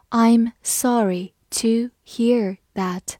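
very slow, every word stretched; slow teacher demo of a sympathetic reply; slow reading. Shown as a demonstration. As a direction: model it slowly for the class. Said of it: "我们把这两句话慢读一遍".

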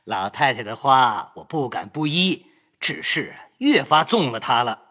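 light laugh before a teasing answer; smiling reply. Acted. Grandson teasing grandmother about his wife, smiling. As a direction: grin as you tease. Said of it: "想必便笑道".

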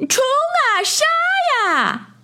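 high, drawn-out charge yell; battle cry. A narrator's reading. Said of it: "一个黄不拉几的家伙大喊".

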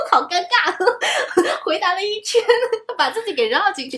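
embarrassed high laughter between words; nervous laugh. Real, not acted. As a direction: laugh awkwardly, caught out.

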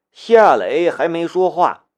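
plain reading voice

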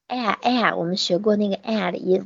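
ordinary delivery

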